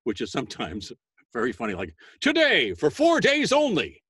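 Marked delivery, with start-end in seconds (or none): 2.2-4.0 high, slow, booming promo read; radio speak. Shown as a demonstration. Voice actor demoing radio speak to kids, booming announcer. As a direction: boom it out like a tractor pull ad. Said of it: "radio speak".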